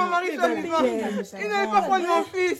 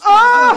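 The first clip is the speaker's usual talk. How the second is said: much louder grief-stricken wail; loud cry; 3 semitones higher, 10 dB louder than usual. Acted. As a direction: scream out in grief. Mother wails.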